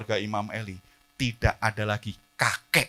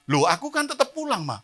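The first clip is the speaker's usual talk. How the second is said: put-on whiny child excuse; 14 semitones higher, 6 dB louder than usual. Child excusing himself to mother.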